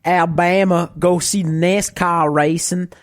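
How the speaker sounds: put-on Southern US accent. Shown as a demonstration. Podcaster doing requested impression for co-hosts, broad Southern accent. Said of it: "the Southern accent?".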